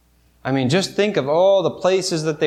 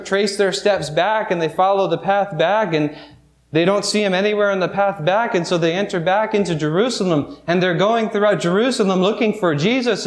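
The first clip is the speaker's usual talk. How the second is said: ordinary talk, nothing marked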